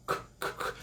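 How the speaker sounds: voiceless "K" clicks, very soft; bare whispered "K" repeated; mute K. Shown as a demonstration. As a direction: release "K" with no vowel after it. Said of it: "no final é um K mudo".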